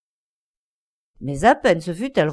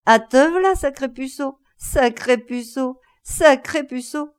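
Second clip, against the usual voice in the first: much higher, loud gleeful cackling repetition; crazy with glee; 6 semitones higher, 4 dB louder, slower than usual. Acted. Coachman mad with glee repeating the joke, high and loud. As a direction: shriek it out, wild with mirth. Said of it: "fou de gaieté, répétait".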